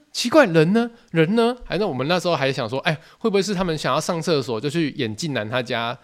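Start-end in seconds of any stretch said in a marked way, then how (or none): 0.1-1.5 high, loud puzzled calling out; looking around for the missing girls; baffled where everyone went. Retold or imitated. Sweating boys wondering aloud where the girls went, high and baffled. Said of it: "我说".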